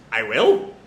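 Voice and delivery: low, doubtful question; understated, puzzled query. Acted. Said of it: "Whisper just questions".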